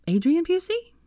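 startled high query; surprised. Acted. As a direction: shoot up in disbelief. Hermione's surprised question.

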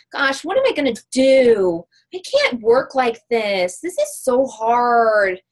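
mope and drag the words out like a downer; Debbie Downer. Retold or imitated. Mocking a seller who messages her to complain, slow whiny moan. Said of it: "you know, Debbie Downer".

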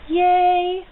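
high, loud happy yay; excited cheer. Real, not acted. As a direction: cheer like you just got the best present. Cheering over a gift.

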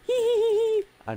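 laughing squeak. Real, not acted. Laughing at himself.

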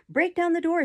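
high, loud frantic yell; scream. A narrator's reading. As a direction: scream to the rescuers outside. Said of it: "screamed the boy".

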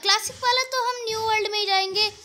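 usual speaking voice

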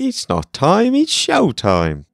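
a mock Cockney wrestling promo; Cockney accent. Asked to repeat Sting's line, a mock Cockney accent. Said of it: "Can you do that in a Cockney accent".

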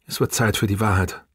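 soft, drained confession; toneless. A narrator's reading. Sue Kim pulls away to confess, toneless and soft. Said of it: "sagte sie mit tonloser Stimme".

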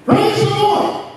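very high, drawn-out praise cry; imitated shout. Old woman finding groceries on her porch, imitated joyful shout. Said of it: "She shouted again".

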